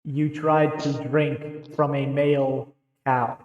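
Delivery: slow, echoey chastising boom. Pretend. Chastising a player for trying to milk a bull, echoey voice. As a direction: draw it out slowly in a big echoey voice. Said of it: "That was my echoey voice".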